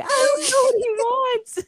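high, strained mock-tearful squeal; holding back tears. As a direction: imitate a helpless kid about to cry. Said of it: "holding back tears".

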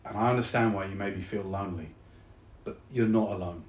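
low, hushed and sincere; tender reassurance. Acted. Soldier tells lonely friend they are loved, tender. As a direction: soften steadily into a quiet, loving admission.

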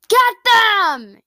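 loud, high attack order; yell. A narrator's reading. Captain sends his legion after the enemy, loud yell. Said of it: "yelled Smog".

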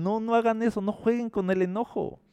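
high-pitched admonishing plea; pleading warning. Acted. Acting out a scriptural warning.

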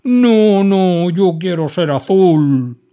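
loud, slow tearful no; whiny refusal. A narrator's reading. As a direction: insist through tears. Dragon refuses crying.

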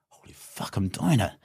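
breathless whispered disbelief; stunned whisper. Retold or imitated. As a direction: barely voice it, amazed. Retold disbelief mid-event.

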